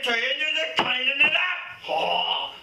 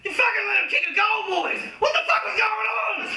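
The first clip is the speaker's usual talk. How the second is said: furious footy fan shouting at the players; angry fan yelling; 7 semitones higher than usual. Acted. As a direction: abuse the team from the stands. Angry fan abusing team.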